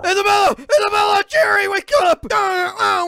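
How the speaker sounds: high sobbing yell; terrified crying shout. Acted. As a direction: cry out in panic from bed.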